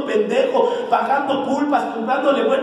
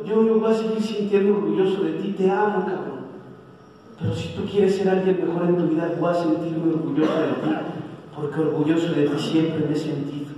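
softer, lower loving words to a son; tender; 7 semitones lower, 3 dB quieter than usual. Real, not acted. Father telling his son he is proud of him, tender. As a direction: reassure him gently, almost breaking.

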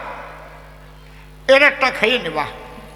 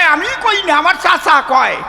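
defensive shout; 7 semitones higher, 4 dB louder than usual. Retold or imitated. Husband protests to the doctor.

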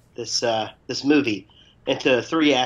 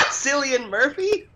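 laughing; 4 dB louder than usual. Real, not acted. Giggling over the actor's name.

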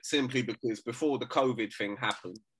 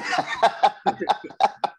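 breathless high cackle; loud wheezing laugh; 8 semitones higher, 7 dB louder than usual. Real, not acted. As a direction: burst out laughing at your own joke. Laughing at own Brum rant.

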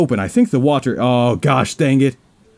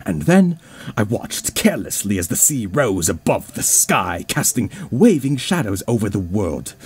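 dreamy narration. Acted. Reads dream narration in game.

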